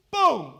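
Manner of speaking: loud, high "boom" sound effect; a shouted blow sound; mock impact boom. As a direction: smack the word like a hit landing.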